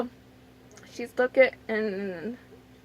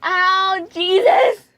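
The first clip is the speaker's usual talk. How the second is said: higher and much louder, sharp; pained exclamation; 5 semitones higher, 8 dB louder, much slower than usual. Real, not acted. The tape tugging her arm, loud pained ow. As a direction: yelp it as the sting hits.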